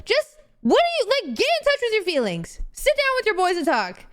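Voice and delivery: high, exasperated acted telling-off; heated plea. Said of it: "I'm getting heated because I'm like".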